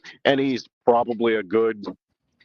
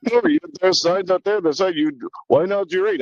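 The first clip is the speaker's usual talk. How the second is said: put-on old man's European accent; 7 semitones higher than usual. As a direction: play the fussy old man scolding in his accent. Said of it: "a little European accent".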